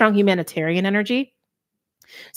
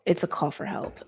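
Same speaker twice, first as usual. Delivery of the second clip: whispered; 6 dB quieter than usual. Real, not acted. Reading the Nightingale card.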